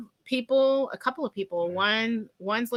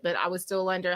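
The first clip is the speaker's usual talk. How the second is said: usual speaking voice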